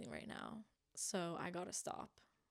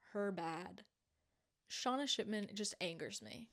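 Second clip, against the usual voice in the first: ordinary delivery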